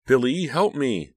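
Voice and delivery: high, loud desperate plea; pleading. A narrator's reading. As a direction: beg loudly, drawing it out. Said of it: "Danielle pleaded".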